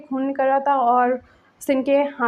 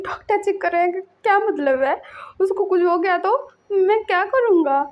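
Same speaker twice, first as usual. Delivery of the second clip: higher, upset questions; retold crying parent; tearful panic; 7 semitones higher than usual. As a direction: plead as the fear overwhelms. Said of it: "बोल के वो रो रहा था".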